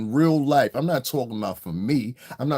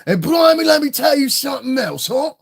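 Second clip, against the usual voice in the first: loud, high-pitched Cockney; brash, exaggerated Cockney impression, pitched way up; Cockney accent; 7 dB louder than usual. Shown as a demonstration. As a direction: burst into a hammed-up Cockney accent. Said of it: "But that Cockney accent".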